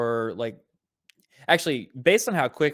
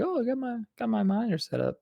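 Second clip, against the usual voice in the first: self-quote; 10 semitones higher than usual. Retold or imitated. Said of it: "so I'm like".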